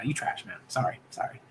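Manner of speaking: a guilty little whispered jab; whispered aside. Real, not acted. Muttered insult then apology.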